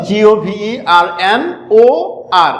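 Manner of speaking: each letter of জিওভিইআরএনওআর spelled out very slowly; slow classroom dictation; slow spelling. Shown as a demonstration. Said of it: "ভালো করে খেয়াল করো".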